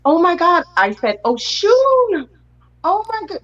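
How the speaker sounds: high-pitched retold gasp of recognition; amazed exclamation. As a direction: relive the thrill, pitch leaping way up. Retells her amazed reaction to the card.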